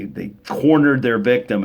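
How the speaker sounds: normal talking voice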